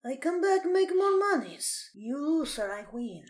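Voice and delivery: put-on thick Russian accent. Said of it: "You're doing a better Russian accent than me".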